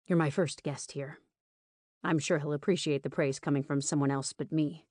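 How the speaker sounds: lightly voiced male lead, restrained; lightly voiced Rowan. Host answers his guest's praise, lightly voiced restrained man.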